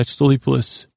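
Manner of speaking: ordinary delivery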